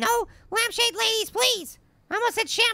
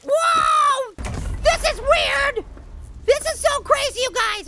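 amazed shout; 4 semitones higher, slower than usual. Real, not acted. Player puts the severed hand in place, amazed shout. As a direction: holler it slowly, blown away. Amazed as game world changes.

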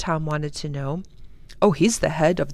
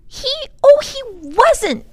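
put-on very high little-girl outcry; high-pitched indignant child; upset protest; 10 dB louder, slower than usual. A narrator's reading. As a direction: squeal in loud protest. Lindy protests the phony accusation.

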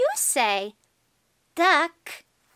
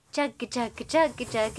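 low, even rhythmic chugging; train chugging imitation; 5 semitones lower than usual. Showing a toddler a train sound, steady low chug. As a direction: chug along like train wheels.

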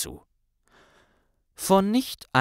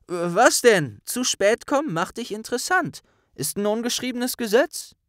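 very high, insistent protest; defensive Ron; 11 semitones higher than usual. Acted. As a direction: defend the rule eagerly. Ron verteidigt sich rasch. (hohe, eifrige Rechtfertigung)

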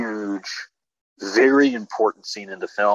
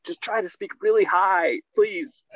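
pleading in a raised, high voice; high-pitched mock plea; 10 semitones higher, slower than usual. Filmmaker coaxing actress in the booth, high pleading. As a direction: beg her lightly, pitched way up.

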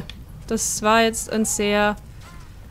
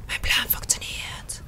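sinister whisper; 6 dB quieter, slower than usual. Pretend. Gloating over the plan.

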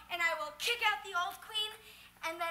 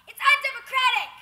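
indignant protest; 8 semitones higher, 14 dB louder than usual. Acted. Protesting being kept from queenship.

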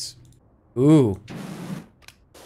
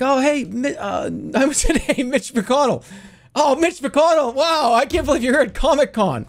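much higher, excited gushing; starstruck fan; 12 semitones higher than usual. Pretend. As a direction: gush at him like a thrilled fan. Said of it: "It's like".

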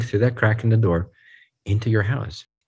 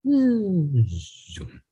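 slowing-down zoom; 6 semitones higher than usual. Acted. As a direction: slide "zoom" down like time decelerating. Imitates time slowing down.

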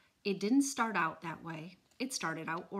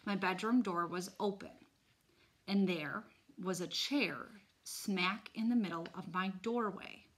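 usual speaking voice

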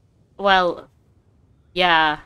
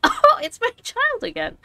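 mock mom voice; 7 dB louder than usual. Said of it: "I was just like".

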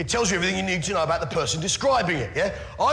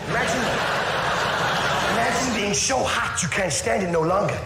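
put-on Dutch accent. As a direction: tell it as a leisurely Dutch-accented pitch. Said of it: "hell in a Dutch accent".

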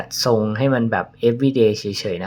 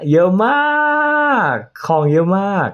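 mock amazement; 9 semitones higher, 4 dB louder, much slower than usual. Shows his huge makeup bag.

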